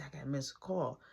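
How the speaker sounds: soft, secretive whisper; retold whisper; hushed remark. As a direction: keep it under your breath. Secretive remark near nana.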